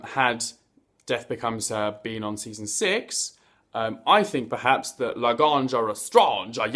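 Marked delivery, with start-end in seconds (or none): none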